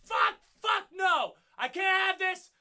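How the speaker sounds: loud, high, frantic pleading; panicked shouting. Retold or imitated. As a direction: scream it as the car is about to hit her. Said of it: "and I was like".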